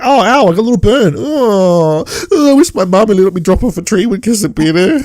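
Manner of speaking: mock whimpering over a tiny burn; whiny character. Mocking the injured character to his co-host, hammed-up whine. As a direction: whine like a spoilt child with a scratch. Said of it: "He's like".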